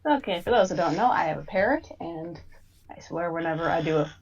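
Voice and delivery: usual speaking voice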